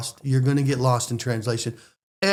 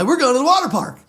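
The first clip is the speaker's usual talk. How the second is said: high-pitched, giddy outburst; excited; 12 semitones higher, 4 dB louder, faster than usual. Retold or imitated. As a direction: blurt it out like a kid thrilled to go. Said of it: "You're all excited about the water park".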